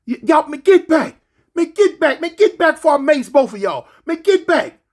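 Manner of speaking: high, loud mock order; imitating an officer yelling; shouted command. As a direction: yell it holding the mace. Rover shouting with mace.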